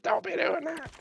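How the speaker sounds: scolding instructor. Retold or imitated. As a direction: imitate a cross skydiving instructor, squeaky and scolding. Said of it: "They'd be pissed".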